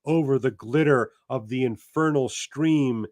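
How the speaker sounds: slow, measured reading aloud; literary reading. A narrator's reading. Teacher reading the novel aloud to class, measured narration. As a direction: savor each image of the passage.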